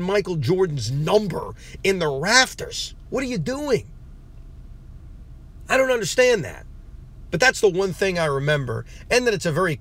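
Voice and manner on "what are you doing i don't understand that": pitched high, incredulous rant; can't believe they'd do this; exasperated disbelief. Real, not acted. Fan ranting about Jordan's number, high exasperated disbelief.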